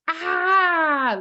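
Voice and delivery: high, loud yell of pent-up energy; release scream. Shown as a demonstration. Kid letting off steam in the bathroom, loud yell. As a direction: let a big yell rip. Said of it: "let it out".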